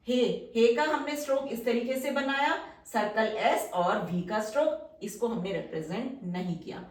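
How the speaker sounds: normal talking voice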